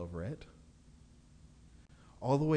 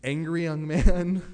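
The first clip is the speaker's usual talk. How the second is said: laughing; 4 semitones higher, 11 dB louder, slower than usual. Real, not acted. Contrasting archetypes, laughing.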